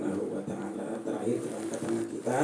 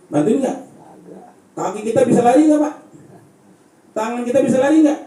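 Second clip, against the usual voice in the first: fiery preaching; 11 semitones higher, 8 dB louder than usual. Acted. Preacher to the village congregation, loud and high. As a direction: preach it to the congregation with full force. Preacher likens death to nightmare.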